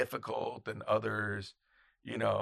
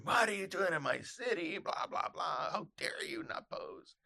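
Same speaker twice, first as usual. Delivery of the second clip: mock tirade, raised pitch without volume; half-voiced hammed-up scolding; berating; 9 semitones higher, 5 dB quieter than usual. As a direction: imitate a ranting photographer under your breath. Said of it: "he just berates them".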